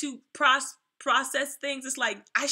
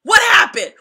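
much higher, loud shouted question; mock angry parent shout; parent yelling; 9 semitones higher, 13 dB louder than usual. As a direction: yell it like a parent catching a lying kid. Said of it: "they be like".

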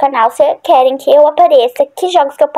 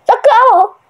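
shrill cry for help; 9 semitones higher, slower than usual. Pretend. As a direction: scream it out as the monster grabs you. Monster attacks her game character.

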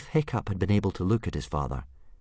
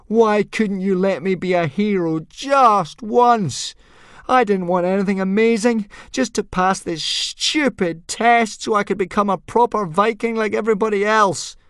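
put-on boy raging at Thor; furious yell; 14 semitones higher, 8 dB louder than usual. A narrator's reading. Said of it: "yelled Hiccup".

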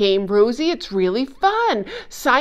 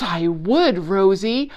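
ordinary talk, nothing marked

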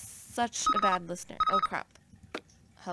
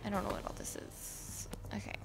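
unvoiced, baffled whisper; 12 dB quieter than usual. Real, not acted. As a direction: breathe the words, puzzled. Confused whisper while playing.

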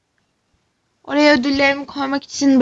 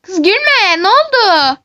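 high, loud upset protest; upset yell; 7 semitones higher, 4 dB louder, slower than usual. Acted. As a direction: tell her off for laughing, alarmed. Upset, friend laughing. (üzgün bağırış)

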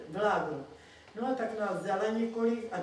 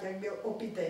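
ordinary delivery

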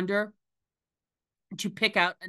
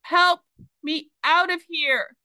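cry for help; 10 semitones higher, 5 dB louder than usual. A narrator's reading. Trapped girl cries for help.

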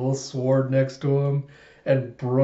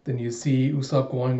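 normal talking voice

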